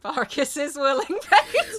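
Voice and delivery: loud, through giggles; laughing. Retold or imitated. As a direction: chuckle the line out. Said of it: "repeating the line, laughing".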